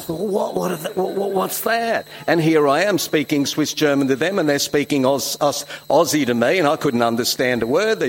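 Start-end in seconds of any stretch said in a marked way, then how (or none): 0.1-2.1 put-on confused little boy. Confused boy among Aussies.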